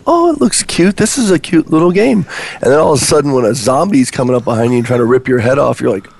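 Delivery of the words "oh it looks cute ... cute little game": cutesy voice. Retold or imitated. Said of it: "you're thinking".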